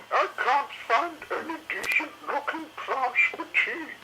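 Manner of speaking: high fretting lady dinosaur; fussy Missus Carey. A narrator's reading. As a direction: complain like a put-upon mother dinosaur.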